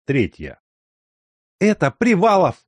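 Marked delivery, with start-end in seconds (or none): 1.6-2.6 high, loud excited cry; a gossip's thrilled announcement; excited exclamation. A narrator's reading. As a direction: blurt out the news with a jolt of excitement. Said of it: "воскликнула Хиония Алексеевна".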